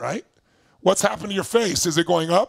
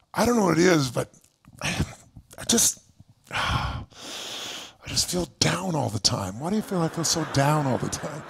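lower, softer, sighing complaint; down and mopey; 5 semitones lower, 4 dB quieter than usual. Retold or imitated. Imitating a stranger on the street who always feels down, low sighing whine. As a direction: sound defeated and sorry for yourself. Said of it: "They'll go".

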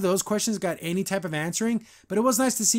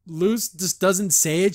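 ordinary delivery

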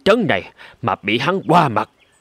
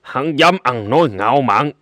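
angry king; 2 semitones higher than usual. Acted. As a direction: growl the outrage at such insolence. King hears of Abu Nawa's boast.

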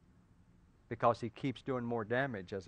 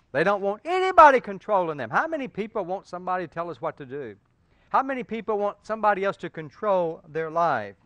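rising preaching; 4 semitones higher, 8 dB louder, faster than usual. Acted. Preaching at full force.

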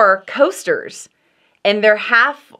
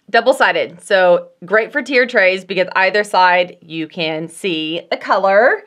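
usual speaking voice

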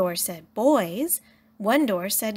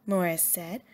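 plain reading voice

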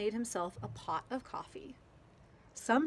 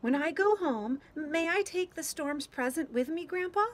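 put-on high little-girl voice, louder, asking sweetly; pleading child; 8 semitones higher, 4 dB louder than usual. A narrator's reading. Stina asking grandpa to take the present home, hopeful. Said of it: "asked Stina".

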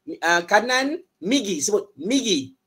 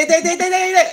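high loud yelps of pain; loud mock pain; 12 semitones higher, 9 dB louder, much faster than usual. Shown as a demonstration. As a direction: scream ow like hurting. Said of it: "kalau sakit".

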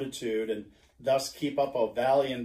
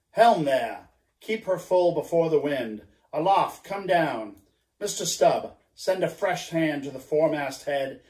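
commanding captain. Acted. Ahab orders the crew for the night, commanding. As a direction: give sharp orders to the crew.

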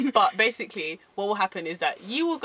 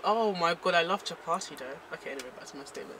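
usual speaking voice

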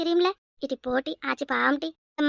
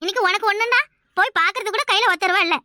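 ordinary talk, nothing marked

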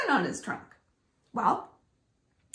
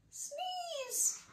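high, soft sneeze; playful sneeze; 13 dB quieter than usual. Acted. Loris sneezing from her cat allergy.

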